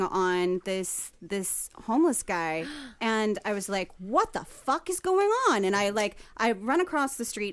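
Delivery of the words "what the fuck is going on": higher-pitched, incredulous retold outburst; shocked disbelief. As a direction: react aghast at what you see. Retold shocked reaction at attack.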